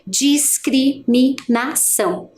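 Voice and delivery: "discriminação" stretched very slowly, syllable by syllable. Shown as a demonstration. Teacher modelling syllables for the class, slow. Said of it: "ouvindo a gente fazer a pronúncia".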